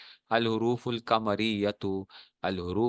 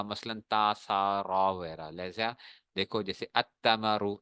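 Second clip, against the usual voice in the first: normal talking voice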